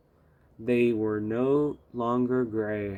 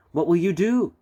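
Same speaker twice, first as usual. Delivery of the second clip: eager question; 6 semitones higher, 6 dB louder than usual. A narrator's reading. Passengers ask eagerly.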